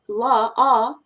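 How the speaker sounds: ordinary delivery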